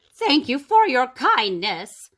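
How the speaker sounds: slow, hammed-up giantess thanks; grateful giantess. A narrator's reading. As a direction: thank them slowly as the giantess. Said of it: "said the giantess".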